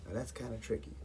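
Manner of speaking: usual speaking voice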